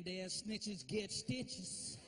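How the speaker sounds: very slow, drawn-out threat rising to a shout; menacing kid. Retold or imitated. Son slowly threatening dad about snitching. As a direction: drag every word out like a threat.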